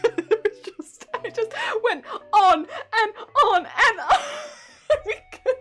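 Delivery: giggling through it; real giggles in the voice; laughing. Real, not acted. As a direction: talk while laughing helplessly. Giggling recalling the call.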